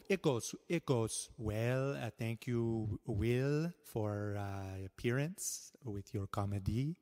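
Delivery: lightly put-on French inspector; hammed-up Inspector Cliche French accent. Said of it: "let's see if Inspector Cliche is here".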